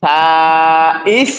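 drawn-out syllable with a rising end; teacher's slow demo; slow model reading. Shown as a demonstration. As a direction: stretch it out slowly for the class to copy. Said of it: "teacher models slow letter reading".

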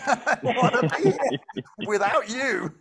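high, loud, through laughter; hammed-up plea breaking into a laugh; laughing mock protest. Meditator telling the mind to go away, laughing mock exasperation.

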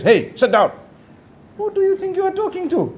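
a husband snapping, pitch jumping high; lightly acted bossy husband putting wife down; mock scolding of the wife; mock angry snap.

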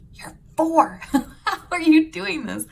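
high, loud amazed disbelief; incredulous. Retold or imitated. Mother to her correcting son, amazed. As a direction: marvel loudly at a four-year-old.

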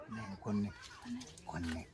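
low, very soft secretive voice; hushed reassurance. Acted. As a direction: keep it down so nobody overhears. Secret talk, checking nobody is around. (दबक्या, खालच्या आवाजात)

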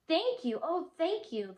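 high, loud heartfelt thanks; grateful cry. Acted. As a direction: cry out in gratitude. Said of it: "the grateful witch cried".

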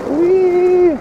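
high gleeful shout; excited whee. Real, not acted. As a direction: squeal with joy while speeding. Speeding downhill on e-bike.

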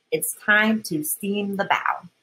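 plain reading voice

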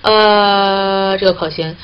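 ordinary delivery

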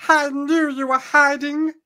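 slow, high, loud sorceress; high-pitched shout. A narrator's reading. Sorceress has found Tarlene hiding, high loud voice. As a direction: crow it high and drawn out. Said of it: "shouts Mordana".

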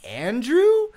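high, rising questioning tone; mock-tentative guess. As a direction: float the name up as if guessing. Pretend guess at the man's name.

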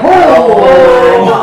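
loud, high drawn-out exclamation; alarmed. Retold or imitated. Reacting to sister's oven temperature, alarmed. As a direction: cry out in mock horror.

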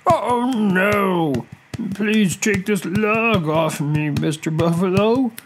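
high, slow crocodile plea; pained, pleading storybook crocodile. A narrator's reading. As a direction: beg for help in a drawn-out wail. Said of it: "pleaded the crocodile".

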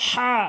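হা with a strong Arabic throat h; correct হা. Shown as a demonstration. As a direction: push a breathy হা from mid-throat. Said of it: "হার সঠিক উচ্চারণ হচ্ছে".

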